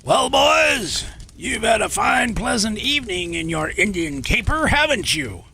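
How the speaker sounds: high-pitched mock admiral calling out; hammed-up officer's hail, high at the start and settling; British admiral. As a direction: call out to the boys as a taunting officer. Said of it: "a British admiral who had watched the entire episode called".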